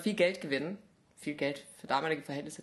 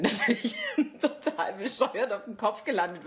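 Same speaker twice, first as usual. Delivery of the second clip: louder, breaking into laughter; laughing while telling; 4 semitones higher, 5 dB louder than usual. Real, not acted. Telling how the somersault ended, laughing loudly. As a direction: laugh through your own mishap.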